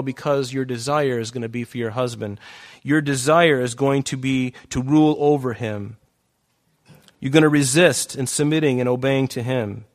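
usual speaking voice

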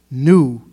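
"knew" hit suddenly loud; stress on knew. Acted. Pastor asking how many knew they would serve Christ, stress on "knew". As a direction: punch "knew" hard, then drop back. Preacher questions the congregation.

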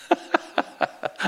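unvoiced high laugh; airy laugh. Real, not acted. Preacher laughing at his own bus joke, airy laugh. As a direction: breathe out a quick laugh.

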